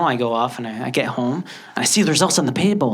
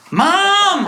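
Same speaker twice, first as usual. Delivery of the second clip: high, loud bratty holler; yelling; 6 dB louder than usual. Acted. Boy calling his mother for money, yelling. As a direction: yell for Mom across the house. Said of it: "why are you yelling at your mama".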